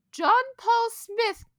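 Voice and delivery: high, loud mock summons by full name; Karen mom accent. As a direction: call the kid in like an angry Karen. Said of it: "that's my accent for, for Karens".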